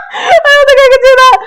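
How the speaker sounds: high, loud squealing laughter; words squeezed out through a real laughing fit; helpless laughter. Real, not acted. Reader giving up on the British accent, laughing hard.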